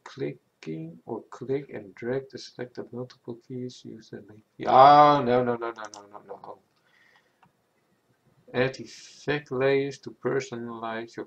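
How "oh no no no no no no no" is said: alarmed no no no. Real, not acted. Lighting setting goes wrong.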